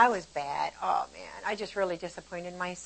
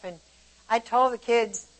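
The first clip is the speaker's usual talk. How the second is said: ordinary delivery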